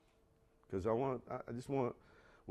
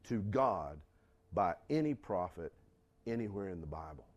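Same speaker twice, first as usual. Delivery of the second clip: ordinary delivery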